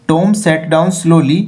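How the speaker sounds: very slow, deliberate reading; slow read-aloud. Shown as a demonstration. Teacher rereading the line to pupils, slowly. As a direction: spell it out slowly for learners.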